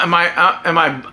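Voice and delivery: ordinary talk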